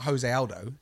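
"Jose" as ho-ZAY before Aldo; rejected pronunciation. Shown as a demonstration. Co-host rejecting the common version, "Jose" as ho-ZAY. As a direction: say "Jose" with an H sound for the J. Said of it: "it's not Jose Aldo".

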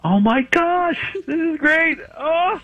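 squealing very high, almost crying with joy; overwhelmed, voice breaking into a happy cry; overjoyed. Real, not acted. Beard contestant squealing to the host, near tears of joy. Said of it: "it sounds like you're pretty excited".

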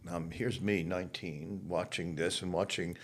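ordinary delivery

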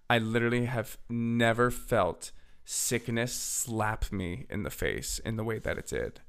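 ordinary talk, nothing marked

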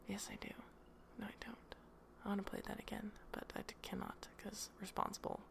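hushed, guilty little whisper; whispered aside. Real, not acted. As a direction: whisper it like a secret confession.

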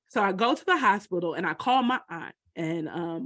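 ordinary talk

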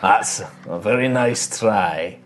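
slow, drawn-out character voice; wild elf captor. Acted. Wild elf answering his captive's bluff, slow and unimpressed. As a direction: dismiss the bluff unhurriedly. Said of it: "this kind of wild elf".

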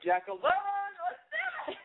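high, slow, stretched out rally cry; big slow high shout to launch the story; excited drawn-out shout. Real, not acted. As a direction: whoop her name like a game show host kicking off. Host hypes up the caller.

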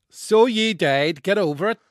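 a hint of Northern English accent, slow. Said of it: "read the intro again in a Northern accent".

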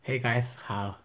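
soft put-on lisp, "guys" ending in TH; lisping flamboyant imitation. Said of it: "that's a lisp right there".

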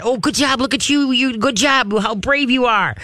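gushing encouragement to a timid dog; cheerleader praise. Shown as a demonstration. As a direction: cheer the puppy on for being brave. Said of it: "you gotta be the cheerleader".

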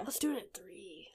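soft unvoiced whisper. Real, not acted. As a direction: whisper it conspiratorially. Late night plans.

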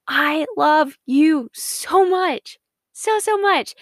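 high, slow and tender, each word drawn out; God's loving voice. Acted. God telling his precious child he loves them, tender and slow. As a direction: pour love into every word, slowly. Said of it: "and he says".

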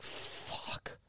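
breathless whispered fuck; whispered curse. Retold or imitated. Stunned clerk cursing to herself, whispered. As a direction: curse under your breath.

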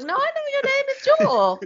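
high goofy insistent; mock Fez insisting. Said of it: "He's like".

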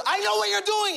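high-pitched accusing wife; accusing mother. Retold or imitated. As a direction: call him out in a high voice. Mother calls out hiding father.

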